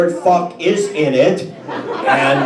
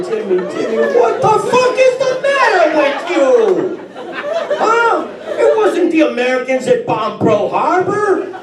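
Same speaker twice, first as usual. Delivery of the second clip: exasperated teacher; 7 semitones higher than usual. Retold or imitated. Teacher calling the clueless student over, mock rage. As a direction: yell at the dumb kid to come here.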